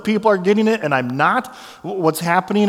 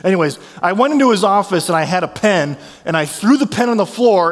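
ordinary delivery

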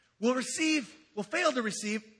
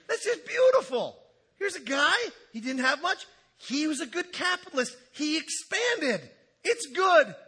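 loud, much higher, sarcastic cheering; mock enthusiasm; 6 semitones higher, 4 dB louder than usual. Preacher mockingly cheering the rich fool's bigger barns, loud and sarcastic. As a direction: shout the praise like a salesman who means none of it. Sarcastic praise of greedy farmer.

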